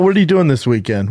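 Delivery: normal talking voice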